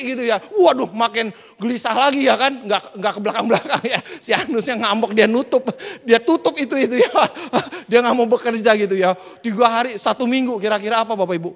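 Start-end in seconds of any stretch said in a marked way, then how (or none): 2.7-9.1 laughing through the words; chuckling as the joke lands. Real, not acted. Preacher laughing at his own joke to the congregation, laughing.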